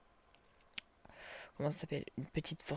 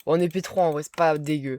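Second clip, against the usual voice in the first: loud and pleased; 15 dB louder than usual. Real, not acted. Pleased with the armour.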